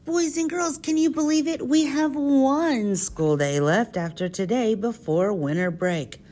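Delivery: put-on high, sing-song voice for little kids; kindergarten teacher voice. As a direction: talk up brightly to small children.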